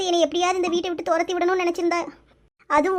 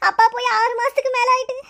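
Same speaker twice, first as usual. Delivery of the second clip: sobbing, voice pitched up; crying; 6 semitones higher than usual. Acted. Girl telling her elder of her missing father, sobbing. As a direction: cry through the words, catching sobs between phrases. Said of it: "அழாத".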